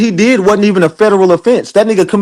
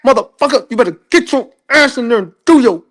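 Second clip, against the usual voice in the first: angry mama; 8 semitones higher than usual. Retold or imitated. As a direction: play a furious mother mid-whooping, pitched up. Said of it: "how your mama used to whoop your ass".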